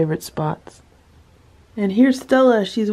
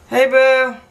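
much higher, louder coo; 7 semitones higher, 5 dB louder than usual. Real, not acted. Opening the door to find Boo, high cooing baby talk. Greeting the cat on rug.

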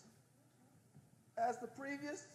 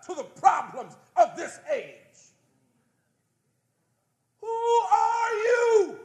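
loud, higher, impassioned sermon shout; preaching at full force; 13 semitones higher, 18 dB louder than usual. Acted. Preacher challenging the prophets in the room, full-force shout. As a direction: rebuke the prophets with fire.

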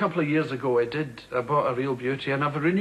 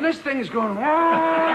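panicked; 10 semitones higher, 4 dB louder, much slower than usual. Retold or imitated. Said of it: "And you're thinking".

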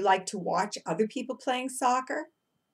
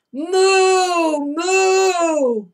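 louder, higher drawn-out cow moo; 8 semitones higher, 9 dB louder than usual. Shown as a demonstration. Teacher mooing loudly with the class. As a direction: belt out a big moo. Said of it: "I'll do it louder with you".

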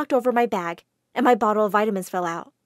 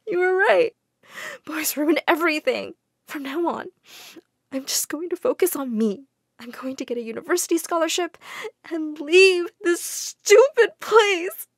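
tearful; 6 semitones higher than usual. Acted. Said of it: "burst into tears".

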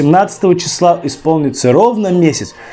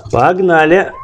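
ordinary talk

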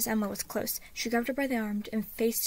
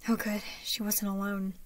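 ordinary delivery

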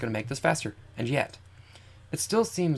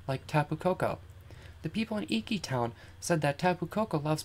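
put-on higher, softer girl character voice; Lily, polite and earnest; 5 semitones higher, 5 dB quieter than usual. Lily telling Blue about the island challenge, earnest. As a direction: read her lines lightly, pitched up.

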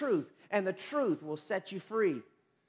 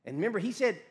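ordinary talk, nothing marked

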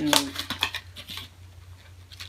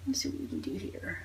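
whispering; 10 dB quieter than usual. Real, not acted. Muttering to herself while drawing.

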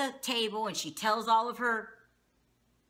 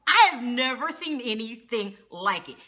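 ordinary talk

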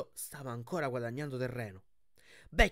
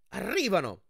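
high warning cry; quick raised call, pitched up; shouting back; 6 semitones higher, 4 dB louder than usual. A narrator's reading. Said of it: "gridò Annabeth di rimando".

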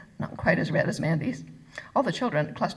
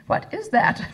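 high, loud fascinated question; 12 semitones higher, 5 dB louder, slower than usual. A narrator's reading. Germaine peering at the strange book, fascinated. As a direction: marvel at it, leaning on "is".

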